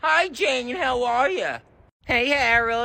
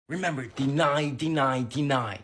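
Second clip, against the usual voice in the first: low, hushed conspiratorial whisper; 12 semitones lower, 8 dB quieter than usual. Acted. Boy reminds brother of the cover story, hushed. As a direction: keep it down so Mom won't hear.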